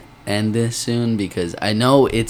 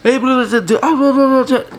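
very high, loud laughing blah-blah; squealing mock chatter; 7 dB louder than usual. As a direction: parody drunk friends yapping. Mocking listeners hearing them.

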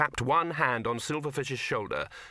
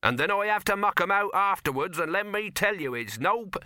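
higher, louder complaining voice; grumbling handleman; 8 semitones higher, 4 dB louder than usual. A narrator's reading. Handleman moaning about mucking out, raised and aggrieved. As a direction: grumble until cut off.

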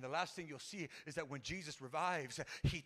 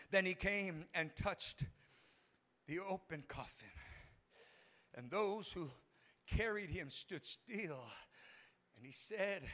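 solemn scripture reading; 4 dB quieter, slower than usual. Acted. Preacher reading the coffin verse to church, slow and hushed. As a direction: read it softly with long pauses.

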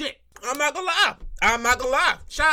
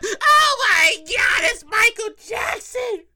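high, drawn-out fan wail; acted front-row meltdown; freaking out; 5 semitones higher, much slower than usual. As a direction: scream it in starstruck hysteria. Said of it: "And you sitting there freaking out".

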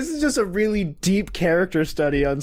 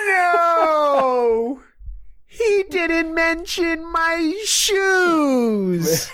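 high, hugely drawn-out mock howl; anguished wail; 13 semitones higher, much slower than usual. Guy in silly shoes crushed at being overlooked, anguished wail. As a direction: wail every word out in mock despair. Inner monologue wailing in dismay.

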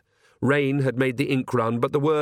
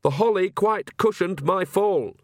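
slow, higher and pointed, fishing for sympathy; pointedly; 8 semitones higher than usual. A narrator's reading. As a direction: lay the hurt pride on each word. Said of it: "said Agnes pointedly".

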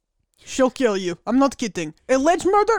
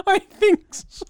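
slow, laughing answer through giggles; breaking into laughter mid-answer; 8 semitones higher, 4 dB louder, much slower than usual. Real, not acted. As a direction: laugh the words out. Laughing at co-host's joke.

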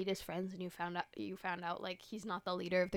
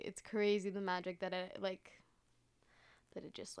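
ordinary talk, nothing marked

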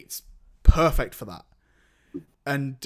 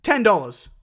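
put-on higher, louder American accent; 6 semitones higher, 6 dB louder than usual. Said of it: "I did American".

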